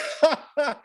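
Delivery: a burst of high laughter. Real, not acted. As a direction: laugh remembering the socks. Laughs about the socks.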